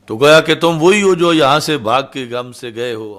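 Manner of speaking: loud, hammering accusation; furious shout. Retold or imitated. Abu Jahl shouting threats at Saad, furious loud shout.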